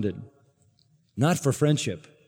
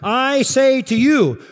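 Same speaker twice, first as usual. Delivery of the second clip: slow, much higher and louder, commanding "I say to you"; divine authority; 11 semitones higher, 8 dB louder, slower than usual. Shown as a demonstration. Preacher voicing Jesus' absolute authority, slow, high and loud. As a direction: proclaim it like God himself speaking. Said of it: "this is the voice of absolute divine authority".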